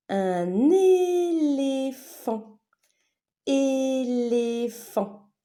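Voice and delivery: "éléphant" high and slow as É-LÉ-PHANT; syllable-by-syllable. Shown as a demonstration. Teacher naming a picture for pupils, syllable by syllable. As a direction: hold every syllable of "éléphant".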